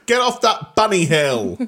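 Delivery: loud, high, drawn-out holler; mock yell. Heckler down on the ground yelling at a skier, mock shout. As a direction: holler it slowly up the slope.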